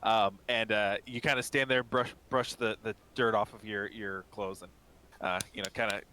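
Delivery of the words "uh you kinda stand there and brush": normal talking voice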